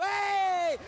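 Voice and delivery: excited shout. Real, not acted. Caster reacts to big play.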